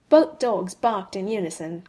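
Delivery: ordinary delivery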